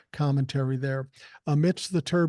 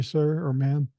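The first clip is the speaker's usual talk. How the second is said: ordinary delivery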